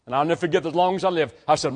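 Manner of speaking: ordinary talk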